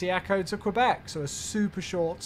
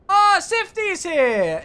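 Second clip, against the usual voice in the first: much higher and louder, slow and stretched; excited drawn-out greeting; 11 semitones higher, 11 dB louder, much slower than usual. Real, not acted. Streamer welcoming a regular viewer to the stream, loud high slow delight.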